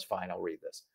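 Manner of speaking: soft, reluctant sigh of a reply; grudging eye-roll. Retold or imitated. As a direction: grudgingly give in to the husband's request. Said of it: "she rolls her eyes and goes".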